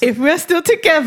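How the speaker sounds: real laughter while setting terms; laughing. Real, not acted. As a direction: giggle the condition. Laughing over the bet.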